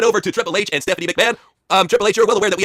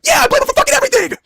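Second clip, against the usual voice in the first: high, loud woken-up yell; mock Braun shout; 4 dB louder than usual. Braun answers the late call, high loud yell. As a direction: bellow it like you just got woken. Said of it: "saying impression".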